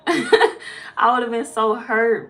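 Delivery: lachend, langsam gedehnt; laughing slowly. Real, not acted. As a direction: laugh through it, stretching the words out. Laughing over bird story.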